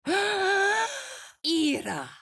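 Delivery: gasp, then fling the word out high; gasp and cry. Acted. Cleric startled mid-spell, gasp and high cry.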